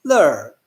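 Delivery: "ler" with the pitch falling; descending tone. Shown as a demonstration. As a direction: slide "ler" downward from start to end. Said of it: "pronounce it in a descending tone".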